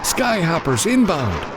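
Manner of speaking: high, urgent call; warning shout. A narrator's reading. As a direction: shout the alarm. Warning shout on train roof.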